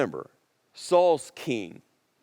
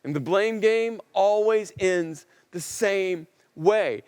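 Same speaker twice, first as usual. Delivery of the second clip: ordinary delivery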